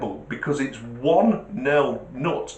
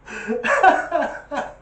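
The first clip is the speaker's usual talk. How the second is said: chuckle; 12 semitones higher than usual. Real, not acted. Laughing again after joke.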